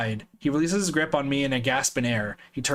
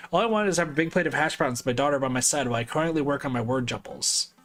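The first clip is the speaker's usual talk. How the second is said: ordinary delivery, nothing marked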